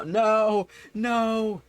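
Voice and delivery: high, loud mock disbelief; playfully scandalized "no, no"; mock outrage. Mock outrage at chat crimes.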